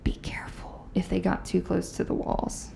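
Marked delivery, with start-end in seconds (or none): 0.0-0.9 ghostly whispered warning. Retold or imitated. Ghost cautioning ride workers, hushed whisper. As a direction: whisper it softly like an unseen voice. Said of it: "a voice warning them".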